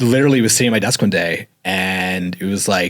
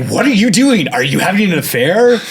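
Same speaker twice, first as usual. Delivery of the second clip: high, loud startled accusation; accusing wife; 10 semitones higher, 6 dB louder than usual. Retold or imitated. Crying wife accuses her husband of an affair, high and loud. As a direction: fire the question right back.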